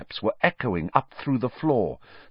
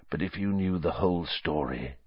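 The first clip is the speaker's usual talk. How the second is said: soft, lower-pitched appeal; quiet pleading; 5 semitones lower, 6 dB quieter than usual. A narrator's reading. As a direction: begin to explain, keeping it hushed.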